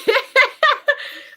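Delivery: high, loud peal of giggles; delighted laugh. Real, not acted. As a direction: burst out giggling after thanking them. Delighted at a viewer's comment.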